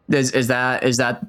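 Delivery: usual speaking voice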